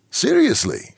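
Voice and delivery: high, emphatic doctor, lightly Ukrainian; emphatic, faint Ukrainian accent. A narrator's reading. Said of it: "emphatic in her faint Ukrainian accent".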